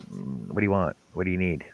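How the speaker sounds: low, soft, knowing suspicion; imitating a resigned, suspicious response; retold wary reply. Retells own wary reply to sibling.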